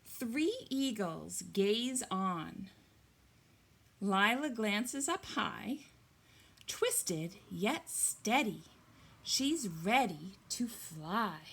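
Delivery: loud, slow picture-book reading; story reading. Acted. Teacher reading the eagle page aloud, storytelling voice.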